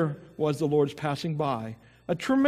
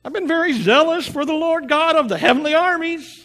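Elijah pleading; 11 semitones higher, 5 dB louder than usual. Acted. Elijah pleads his case to God.

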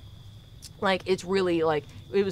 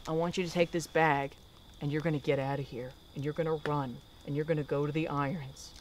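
low, soft, steady urging; hushed instructions; 4 semitones lower, 8 dB quieter than usual. Retold or imitated. Dad telling daughter to run, hushed instructions. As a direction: quietly send the child away.

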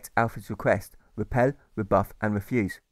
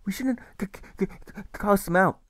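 high, halting stammer on cast; a boy's timid but firm protest; stammering reply; 9 semitones higher than usual. A narrator's reading. Alfred stammers his reply to the carer. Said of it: "Alfred replies".

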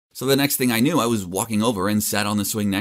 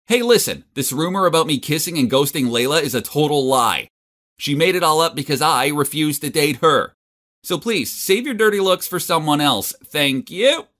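louder, higher shout to the room; public shout; 5 semitones higher, 4 dB louder than usual. Retold or imitated. Felix announces to the whole lunchroom, shouting. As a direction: call it out to the whole cafeteria. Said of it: "Then I shouted so everyone could hear me".

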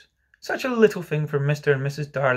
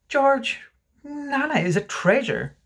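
high, slow reproach; protesting wife; 12 semitones higher, slower than usual. Acted. Wife defends Nana to George, high and insistent.

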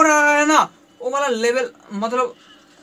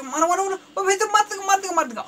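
high, frantic repeated pleas; panicked urging; 8 semitones higher, 4 dB quieter, much faster than usual. Real, not acted. Gamer afraid the enemy will kill him, high panicked chatter. Urging shots, fearing being killed.

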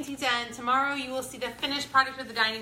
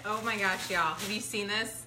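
ordinary talk

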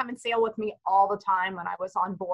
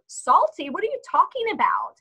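higher-pitched incredulous question; retold bafflement; 7 semitones higher than usual. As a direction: replay your baffled reaction to mom. Acting own baffled reply to mother.

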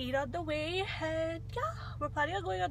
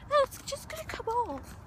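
much higher, badly faked British accent; mock British accent; 11 semitones higher than usual. Mimicking him shrugging off the ladybug, mock British accent. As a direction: imitate him in an unconvincing British voice. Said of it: "probably didn't even sound like a British accent".

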